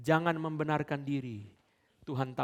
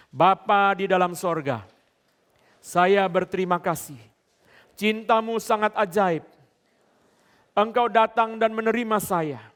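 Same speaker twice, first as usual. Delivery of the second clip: loud fervent prayer; 8 semitones higher, 14 dB louder than usual. Acted. Preacher leading the standing church in prayer, loud and fervent. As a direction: lift the prayer up for the whole room to repeat. Leads congregation prayer line by line.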